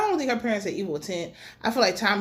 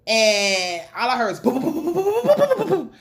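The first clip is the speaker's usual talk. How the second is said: banging noises imitated; 3 semitones higher, 5 dB louder than usual. Imitating poles banging in car.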